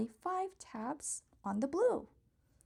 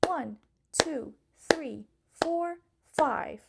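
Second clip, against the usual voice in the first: counting claps louder and louder; 7 dB louder, slower than usual. Pretend. Counting claps with a child as the dots grow, louder and louder. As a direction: build the count up until five lands loud.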